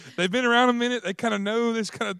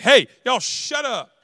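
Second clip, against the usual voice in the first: slow, louder scolding parent; parent telling kids off; 5 semitones higher, 5 dB louder, much slower than usual. Retold or imitated. Parent hushing noisy kids during football, slow scold. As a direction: drag it out like a fed-up dad. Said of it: "somebody's mom or dad is like".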